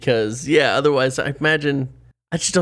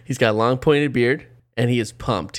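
usual speaking voice

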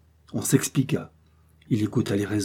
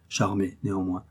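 ordinary delivery

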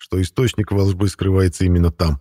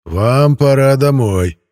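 slow, raised, stretched vowels; vampire drawing out the A; 5 semitones higher, 5 dB louder, much slower than usual. A narrator's reading. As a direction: drag out the "а" in "пора". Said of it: "Он странно растягивал букву «А»".

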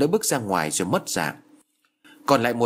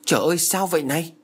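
higher, fast startled cry; cry out in shock; 6 semitones higher, faster than usual. A narrator's reading. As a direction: blurt it out, caught off guard. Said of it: "kêu lên". (kêu lên hoảng hốt, cao và nhanh)

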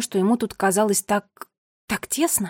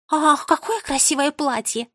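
high, drawn-out sighing admiration; mock gushing; 5 semitones higher than usual. A narrator's reading. Sister mimics her smitten brother, mock gushing. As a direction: gush in a put-on high voice.

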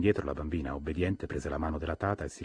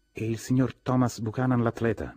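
raised, questioning lift; inquiring; 6 semitones higher, 4 dB louder than usual. A narrator's reading. Guest asking after the master of the house, inquiring. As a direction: ask after him with higher pitch. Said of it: "mi informai".

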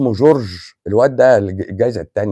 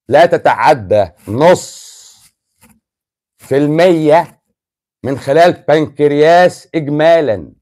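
slow dictation; 3 dB louder, slower than usual. Shown as a demonstration. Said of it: "احفظ الكلمه دي ورايا".

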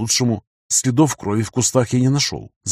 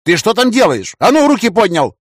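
shouting; 9 semitones higher, 5 dB louder than usual. A narrator's reading. Threatening captive with a gun.

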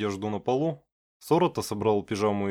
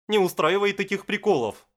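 storming off shouting; 7 semitones higher than usual. A narrator's reading. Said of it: "проорал Сорота".

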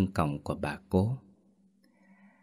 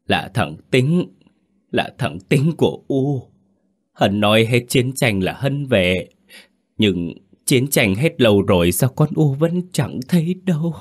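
grieving lament; 5 semitones higher than usual. Acted. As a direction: plead in bewildered grief, rising toward the end. Grieving mother laments missing son.

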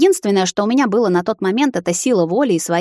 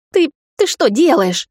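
high, slow stammered bark; alarmed bark; 9 semitones higher, 4 dB louder, slower than usual. A narrator's reading. Vampire sees her thawing the puddle, high alarmed bark. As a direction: protest in alarm, stumbling on the first word. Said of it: "рявкнул высший".

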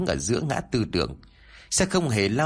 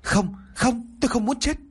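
high, desperate crying out; panicked scream; 13 semitones higher than usual. A narrator's reading. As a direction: scream it in terror. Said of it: "cô ta luôn miệng gào thét".

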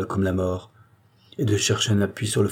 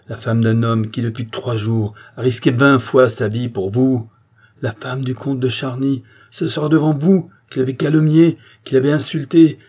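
loud, raised, forceful tirade; indignant rebuke; 4 semitones higher, 7 dB louder than usual. A narrator's reading. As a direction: build accusations to a commanding finish. Charny rebukes the queen, quarrel.

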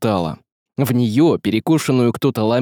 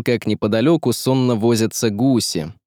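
ordinary delivery, nothing marked

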